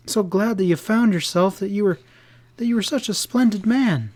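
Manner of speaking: high, pleading, upset woman; crying out in reproach. A narrator's reading. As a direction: reproach him with hurt and disbelief. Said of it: "she cried".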